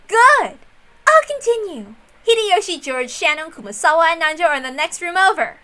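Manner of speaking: high, bright and pushing on. Acted. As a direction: press ahead with glee. Erica eagerly listing.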